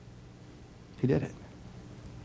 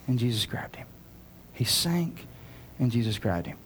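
usual speaking voice

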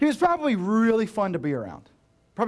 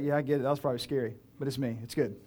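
softer, calm comfort; quiet kind reassurance; gentle reassurance; 4 semitones lower, 6 dB quieter, faster than usual. Retold or imitated. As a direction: soothe a scared friend. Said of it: "It's like".

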